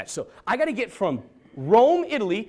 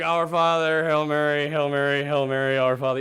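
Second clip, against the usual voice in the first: rattled-off prayer; 8 semitones lower than usual. Retold or imitated. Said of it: "I'm like".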